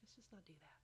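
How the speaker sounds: very soft, hushed aside; whispered nudge. Acted. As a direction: whisper it like a private prompting in the ear. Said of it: "gonna whisper in your ear".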